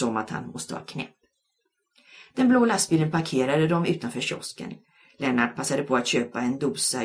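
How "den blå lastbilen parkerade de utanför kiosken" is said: ordinary delivery, nothing marked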